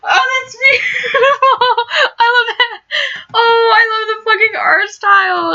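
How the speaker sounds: high, slow, laughing and teary rapture; overwhelmed by how beautiful it is; gushing. Real, not acted. Gamer moved by the art.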